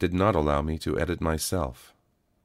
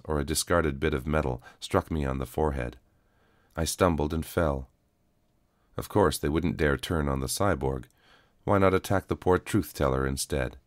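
plain reading voice

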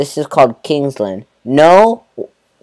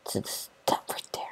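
breathy whispered aside, no voice; 20 dB quieter than usual. Real, not acted. Gamer whispering to his viewers about the stuff. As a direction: drop to a secret whisper.

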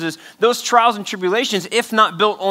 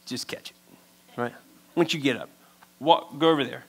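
muttered retort; 3 semitones lower, 6 dB quieter than usual. Retold or imitated.

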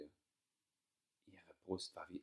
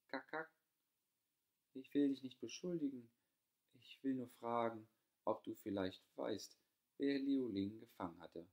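gentle younger sister; 5 semitones higher than usual. A narrator's reading. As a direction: ask her sister gently, careful not to accuse. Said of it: "Sanft fragte Niu Bongsu Rarang".

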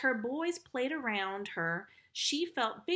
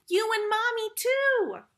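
jolly; 11 semitones higher, 8 dB louder than usual. Acted. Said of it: "who was in a jolly mood".

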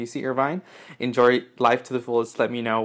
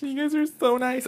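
tiny high squeaky thanks; touched gushing; 13 semitones higher than usual. Real, not acted. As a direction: squeak it, overcome with gratitude. Touched, thanking fans for gifts.

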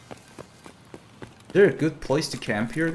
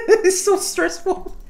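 giddy laughing; slower than usual. Real, not acted. Giddy after fight.

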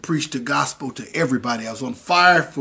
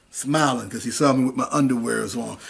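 ordinary delivery

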